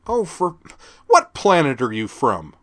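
high, loud, incredulous sneer; mock his ignorance; exasperated scorn. A narrator's reading. Teen girl scorning the sergeant, high exasperated sneer. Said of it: "Laura asked".

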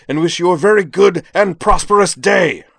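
high, very loud, word-by-word bellow; shouted sign-off. A narrator's reading. Jakron storms to a close before the dean, shouted sign-off. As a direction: hurl the courtesy like an insult. Climax of an indignant tirade.

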